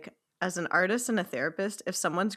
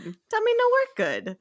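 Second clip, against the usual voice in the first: 1 high, slow silly voice; 13 semitones higher, 4 dB louder, slower than usual. Retold or imitated. Echoing the silly phrase.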